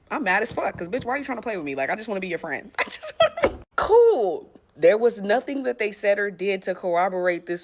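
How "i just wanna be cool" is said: very high, slow squeaky whine; whiny squeak. Retold or imitated. Comic teller whining at the strangers, squeaky high whine. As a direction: wail it like a hurt kid. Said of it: "whiny mock plea".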